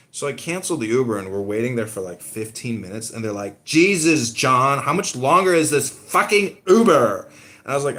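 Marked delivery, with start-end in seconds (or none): 3.6-7.3 loud, impatient complaint at John; annoyed friends. Retold or imitated. Girlfriends waiting for the Uber, loud and impatient. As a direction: friends fed up, demanding where the ride is.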